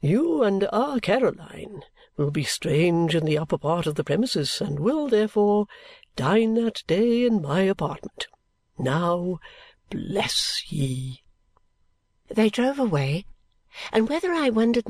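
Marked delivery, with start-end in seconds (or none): 0.0-11.2 put-on pompous, stately old father; magnanimous patriarch giving his blessing; great air. A narrator's reading. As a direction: pronounce it grandly, with drawn-out words. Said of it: "with a great air".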